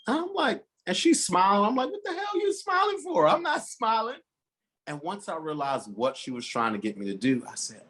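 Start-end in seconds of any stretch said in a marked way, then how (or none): 1.9-4.2 mock exasperation. Retold exchange with smiling aunt.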